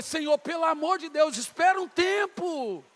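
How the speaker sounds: high plea, stretched out at the end; exasperated plea. Retold or imitated. Begging God to wait before he calls, exasperated plea. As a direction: beg for a moment's respite.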